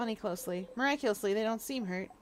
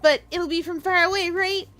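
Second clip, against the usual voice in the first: high, loud and pleading; eager girl character; 6 semitones higher, 9 dB louder than usual. Acted. Bonnie begging friend to teach her fighting, high and eager. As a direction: talk herself up, rushing with eagerness.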